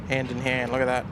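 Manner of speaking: usual speaking voice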